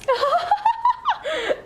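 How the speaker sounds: bright burst of high laughter; high giggle. Real, not acted. Guest cracking up while telling the prank story. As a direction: laugh out loud at the memory.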